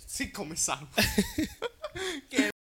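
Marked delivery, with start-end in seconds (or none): 0.9-2.2 high burst of laughter. Real, not acted. Laughing at friends' joke, high burst.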